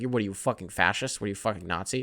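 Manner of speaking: mock accuser. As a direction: sneer it under your breath. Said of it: "Someone's like".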